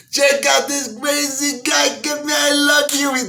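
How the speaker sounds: high, slow mock mobster delight; amused gangsters. Mafia guys delighted by Pryor, high mock mobster voice. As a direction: gush like a grinning wise guy. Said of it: "This is what they said".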